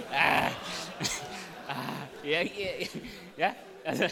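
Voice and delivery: loud laughter through the words; laughing. Real, not acted. Preacher laughing at his own rabbit joke, loud laugh.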